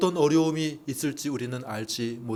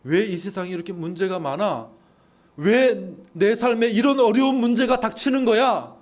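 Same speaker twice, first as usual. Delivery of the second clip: louder, higher anguished questioning; despairing complaint; 8 semitones higher, 8 dB louder than usual. Retold or imitated. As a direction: cry out against life's troubles. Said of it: "결론을 내버립니다". (삶의 문제 앞에서 절망하며 높고 크게 외치는 불평)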